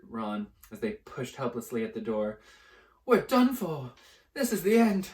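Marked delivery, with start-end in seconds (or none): 3.0-5.1 high, loud doom; despairing. Acted. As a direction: cry out hopelessly. Ron despairs at locked door.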